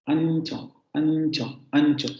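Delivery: ancho repeated, nasal before ch stressed; repeated example word. Shown as a demonstration. Teacher repeating ancho so students hear nasal. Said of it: "En una palabra como ancho".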